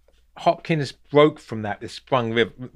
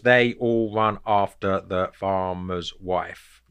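slow, lower, syllable-by-syllable; measured, evenly spaced syllables in "They all run after the farmer's wife"; counting syllables; 4 semitones lower, slower than usual. Shown as a demonstration. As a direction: separate every syllable as if counting them out. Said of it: "I count those".

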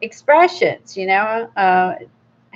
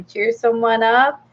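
ordinary delivery

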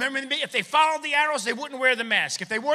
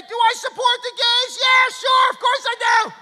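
loud, higher confused shout; shouting back; 12 semitones higher, 9 dB louder than usual. Retold or imitated. Customer shouting back at the cashier, loud and confused. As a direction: yell the misheard answer.

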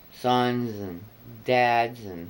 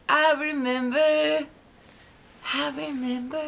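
high mock voice. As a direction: pitch it way up, playful.

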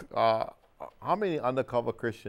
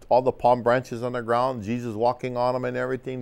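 ordinary talk

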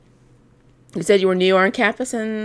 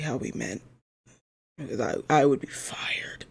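whispered aside; 6 semitones lower, 10 dB quieter than usual. Acted. Worried aside to viewers.

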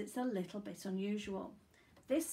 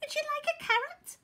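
very high, quick bunny voice; rabbit; 6 dB louder, much faster than usual. A narrator's reading. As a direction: squeak a quick question as a rabbit. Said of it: "asked a rabbit".

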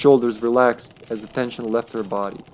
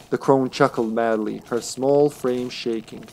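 ordinary talk

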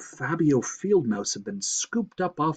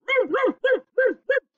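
rapid, high, loud yaps; high-pitched frenzied barking; mad dog barking; 5 dB louder than usual. A narrator's reading. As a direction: bark like a frantic little dog. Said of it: "Randolph barking like mad".

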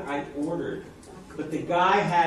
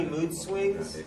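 ordinary delivery

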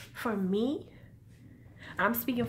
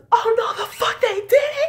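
very loud, high yell with a laugh; yelled in theater; 13 semitones higher, 12 dB louder than usual. Retold or imitated. Fan in the theater at the letdown, a loud high laughing yell. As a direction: shout it across the cinema in disbelief. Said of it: "I yelled in the theaters".

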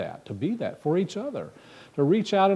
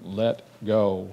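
very slow, solemn voice from above; God speaks to the man on the branch, slow and majestic; 3 semitones lower, 4 dB louder, much slower than usual. Acted. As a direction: announce it like the Almighty, taking your time. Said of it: "and the voice says".

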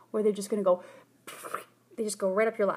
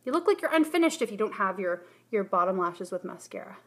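ordinary talk

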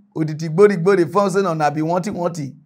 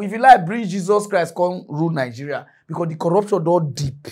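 ordinary delivery

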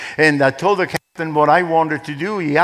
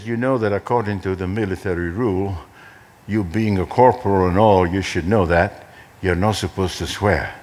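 calm rebuke; 8 semitones lower than usual. Retold or imitated. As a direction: answer the shouting with cool composure. Calm reply to a yelling corporal.

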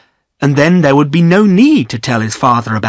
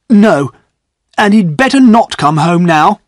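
louder, higher angry retort; angrily; 5 semitones higher, 5 dB louder than usual. Acted. Godfrey tells Bryce his brother had better stay away, louder and angry. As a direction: spit out his anger at his brother. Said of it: "replied Godfrey angrily".

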